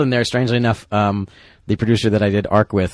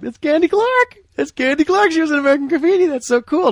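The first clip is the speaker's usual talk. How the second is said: very high, louder fanboy squeal; geeking out; 5 dB louder than usual. Retold or imitated. Crew member spotting his screen idol, geeking out. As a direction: squeal it like a starstruck fan. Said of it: "I was, like, geeking out".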